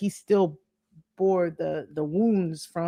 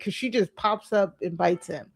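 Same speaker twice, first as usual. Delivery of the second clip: usual speaking voice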